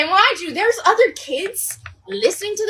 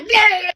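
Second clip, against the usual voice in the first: loud, higher mock vomit noise; imitated retching; 4 semitones higher, 5 dB louder than usual. Teller throws up in front of friends, imitated retching. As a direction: spew out a fake retch.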